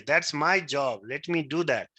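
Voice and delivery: ordinary talk, nothing marked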